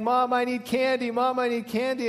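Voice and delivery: imitated little girl pestering mom; whining child. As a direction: plead on one high note like a begging child. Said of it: "she began to whine all the more".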